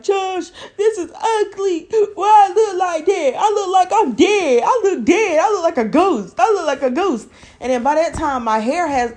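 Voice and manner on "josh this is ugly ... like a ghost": high, loud laughing sob; crying. Retold or imitated. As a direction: wail it half laughing, half crying. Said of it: "I'm crying at that point".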